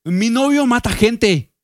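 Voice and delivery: high, drawn-out mock girl voice; hammed-up high imitation; imitated TikTok girl.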